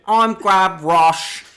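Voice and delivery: slow, high, loud mock Cockney boy; Cockney accent. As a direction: ham up a Cockney street kid. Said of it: "strongest Cockney accent".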